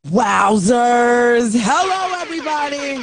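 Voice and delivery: loud, drawn-out rising hello; big show greeting. Real, not acted. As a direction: belt out a long host's greeting.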